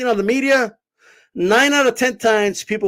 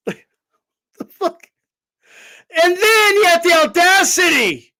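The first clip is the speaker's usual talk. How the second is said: laughing disbelief; 8 semitones higher, slower than usual. Real, not acted.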